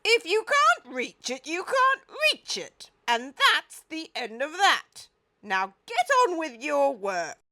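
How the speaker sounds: high, loud stern scolding; stern Duke. A narrator's reading. The Duke orders the gang back to work. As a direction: end the argument sternly.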